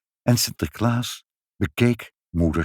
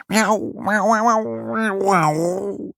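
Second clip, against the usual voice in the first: high, drawn-out yowling meows; high overlapping-style cat chorus; excited cat meowing; 12 semitones higher, much slower than usual. A narrator's reading. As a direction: meow excitedly like two cats at once. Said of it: "heel opgewonden".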